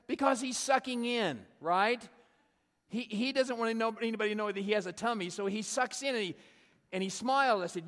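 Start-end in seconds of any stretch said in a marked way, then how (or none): 0.1-4.3 突然拔高、更响地解释; excited explaining. Retold or imitated. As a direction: punch it up like a reveal. Friend explaining his photo trick.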